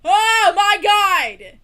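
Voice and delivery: stunned yell; shocked yell. Real, not acted. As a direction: let it burst out. Loud shocked exclamation.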